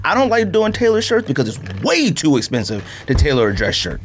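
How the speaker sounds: ordinary talk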